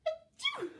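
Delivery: loud, very high acted sneeze; a big hammed-up achoo. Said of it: "the woman sneezed".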